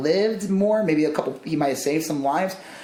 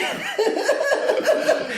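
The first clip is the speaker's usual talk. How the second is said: very high falsetto squeal laugh. Real, not acted. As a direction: shriek with laughter.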